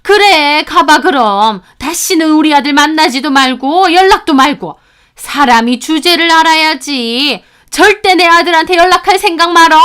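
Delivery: put-on loud, high, scornful scolding of a rejected girlfriend; contemptuous scolding. Boyfriend's mother driving off son's girlfriend, loud scornful scolding. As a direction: shout her down like a haughty mother-in-law.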